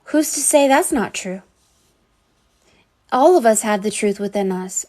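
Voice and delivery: ordinary delivery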